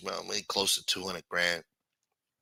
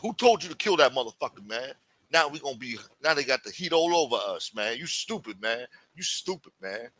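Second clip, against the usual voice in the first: exasperated scolding; 4 semitones higher than usual. Retold or imitated. Accomplice berating the shooter about the heat, exasperated scolding. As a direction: berate him, then sink into disgust.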